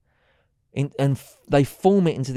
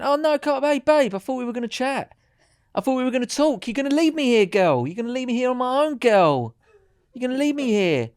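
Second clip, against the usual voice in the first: much higher, pleading put-on whine; mock needy flirt; 8 semitones higher, 3 dB louder than usual. Fundraiser begging wife not to leave, mock needy whine. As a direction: whine like a clingy charmer. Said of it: "have you got time to talk".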